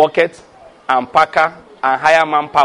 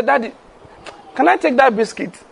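put-on child asking dad; 7 semitones higher than usual. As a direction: imitate a kid sweetly asking a favor. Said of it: "They'll come and say".

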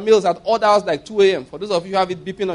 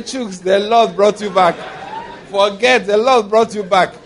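teasing through laughter; 4 dB louder, slower than usual. Retold or imitated. Teasing friend, repeating beggar's line.

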